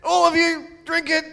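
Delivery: high, loud raging rant, building to a yell; mock rage. Moses in absolute rage at the people, loud high ranting. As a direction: act out a furious Moses forcing them to drink. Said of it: "a moment of absolute rage".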